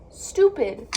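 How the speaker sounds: stupid blurted out loud; frustrated loud outburst. Real, not acted. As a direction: snap out stupid at full volume. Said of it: "I'm so upset".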